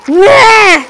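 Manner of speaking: high, loud outburst; sharp high cry; sudden shout. Real, not acted. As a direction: yell it out suddenly. Sudden fight in the game.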